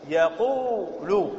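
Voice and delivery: very slow "يقول" as ya-qoo-lu; resulting form. Shown as a demonstration. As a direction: hold the long u, then add lu: "يقول" as ya-QUUU-lu. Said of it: "فتصير ماذا؟".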